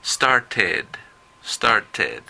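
"started" said with a full ed syllable; teacher's model word; ed as extra syllable. Shown as a demonstration. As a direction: repeat "started" with the ed spelled out. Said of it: "Se pronuncia como se lee".

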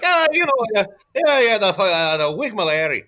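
put-on loud, high slurring drunk Scot; whiskey-soaked drunk. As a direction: blurt it like a drunk full of whiskey. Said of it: "somebody who had too much whiskey".

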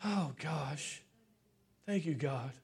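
low, soft, holding back tears; overwhelmed. Real, not acted. Preacher overcome before his church, quietly overwhelmed. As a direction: murmur thanks, struggling not to cry. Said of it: "I'm just overwhelmed".

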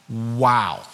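low, underplayed wow; unenthused wow. Shown as a demonstration. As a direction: say wow low, with little enthusiasm. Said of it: "like you're not rolling your eyes".

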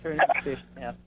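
bad phone line, cutting out; faint, breaking up on the line; voice fading in and out. Real, not acted. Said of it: "your voice is fading in and out".

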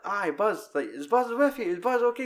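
high, loud questioning calls; high-pitched toy voices demanding to see Buzz; suspicious toys calling. Retold or imitated. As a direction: call up high across to the window, doubtful. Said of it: "to convince him".

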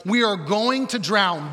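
loud, high cry for rescue; desperate shouting. Acted. Disciples waking Jesus in the storm, shouting. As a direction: shout it out slowly, drawing out each plea.

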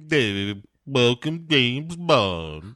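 slow, drawn-out villain welcome; Blofeld. Acted. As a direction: draw each word out like a supervillain. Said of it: "Blofeld interrupts the proceedings by going".

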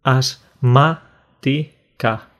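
asmática split slowly into as-má-ti-ca, each syllable apart. Shown as a demonstration. Teacher showing students asmática split syllable by syllable. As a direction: spell out asmática slowly, beat by beat. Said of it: "separando sílaba por sílaba".

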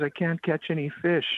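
put-on high, plaintive little-girl voice; pitiful child's cry. Crying little girl to the tour owner, acted pitiful whine. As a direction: act a tearful child's complaint. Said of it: "like, pitifully crying".

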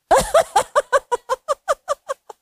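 very high, long cackling peal; put-on rapid-fire laughter; demonstrated laughter. Shown as a demonstration. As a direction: laugh high and long to get others joining. Prompts louder laughing after "can't hear.

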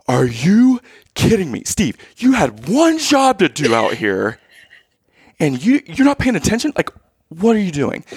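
high, indignant complaint, slowing on the middle line; exasperated outburst. Retold or imitated. Scolding the distracted umpire dad, high and indignant. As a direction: scold him for not paying attention. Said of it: "I'm like".

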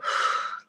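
soft, audible exhale; releasing sigh. Shown as a demonstration. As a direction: breathe out to release the upset. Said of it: "Let me release it".

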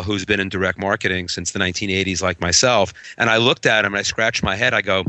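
usual speaking voice

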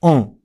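オン as an open-mouthed nasal from the back of the throat; throaty French nasal オン; muffled nasal vowel. Shown as a demonstration. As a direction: say オン as a muffled アン, close to オン. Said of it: "喉の奥でくぐもった声で".

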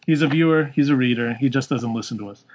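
ordinary talk, nothing marked

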